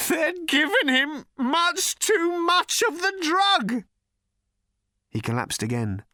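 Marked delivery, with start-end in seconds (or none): 0.1-3.8 high, slow, loud anguished shout. Acted. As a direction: shout it in despair. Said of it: "shouted at them".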